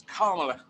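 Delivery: Kamala with the first syllable stressed, KAR-mala. Shown as a demonstration. Teaching an interviewer to say Kamala correctly, stressed first syllable. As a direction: say Kamala as KAH-muh-luh, weight on the first syllable. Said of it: "emphasize the first syllable of her name".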